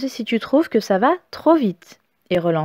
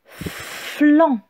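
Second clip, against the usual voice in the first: syllabe seule, détachée et un peu plus aiguë; répéter le mot de la dictée; dictée articulée; 4 semitones higher than usual. Shown as a demonstration. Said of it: "c'est la syllabe flanc". (voix de dictée nette)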